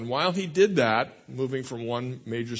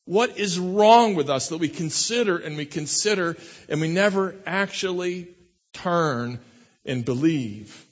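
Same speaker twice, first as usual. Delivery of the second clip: retold anguished cry; 5 semitones higher, 4 dB louder than usual. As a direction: cry out to a friend, mildly dramatized anguish. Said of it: "cried out in anguish to his friend".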